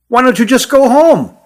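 high-pitched, pleading objection; protesting question. A narrator's reading. Hut objects to leaving.

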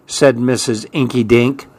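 plain reading voice

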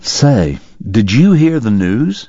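a hint of an old man sharing gossip; doctor turns to the boy with the bank news, slowly; lightly voiced doctor.

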